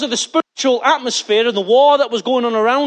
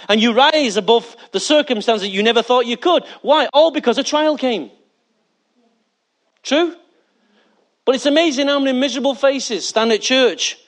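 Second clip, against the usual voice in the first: usual speaking voice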